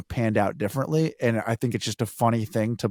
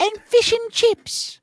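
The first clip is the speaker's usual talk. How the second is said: high, slow mock British lady accent; a silly falsetto British lady accent; crazy British lady accent; slower than usual. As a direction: ham up a British lady's accent, pitched way up and drawn out. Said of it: "my crazy British lady accent".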